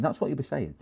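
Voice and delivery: ordinary talk, nothing marked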